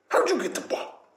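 high-pitched pet-talk question; playful falsetto for a dog. Shown as a demonstration. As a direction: quiz the dog in a squeaky voice. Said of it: "that is the voice that I use to talk to her".

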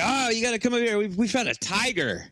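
high, urgent acted call; excited servant. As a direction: play an eager servant luring someone over. Excited servant luring the travelers.

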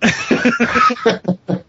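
high helpless laugh; burst of laughter. Real, not acted. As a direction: crack up laughing. Reacting to a rude joke.

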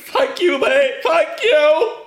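high, loud, overwhelmed thank you; tearful thanks. Retold or imitated. Paul thanking his friend for booking the flight home, tearful. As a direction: gush with relief on the verge of tears. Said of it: "burst into tears".

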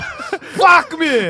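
put-on high, shouted Austrian-accented interjection; Arnold Schwarzenegger. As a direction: blurt it like an action hero.

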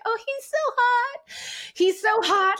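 high, breathy swooning over a crush; swooning girl voice. Retold or imitated. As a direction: gush like a lovestruck girl. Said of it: "'cause we're so like".

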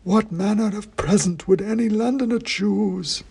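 high, slow, dusty oracle voice; dusty faint ancient head. A narrator's reading. Ancient king's head answering the wizard, slow high dusty chant. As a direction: intone it like a faint, dusty myth. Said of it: "said Bran".

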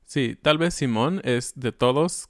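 ordinary talk, nothing marked

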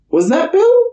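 very high, squeaky startled question; mock alarm. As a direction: squeal it in comic disbelief. Acting out own startled reaction.

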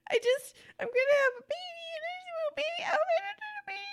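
very high, fast teary gush; giddy near tears. Real, not acted. Overwhelmed birthday girl gushes about her baby elephant, teary and high.

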